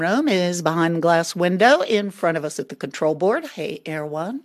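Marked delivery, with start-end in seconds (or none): none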